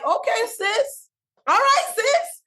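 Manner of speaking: high, drawn-out mock agreement; sarcastic okay sis. Answering the woman who mocked her accent, high and dismissive. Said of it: "I remember just being like".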